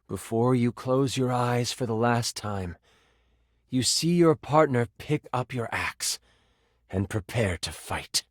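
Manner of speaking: low, grave storytelling with pauses; solemn death-scene narration. Acted. Game master tells dying player's last sight, solemn and low.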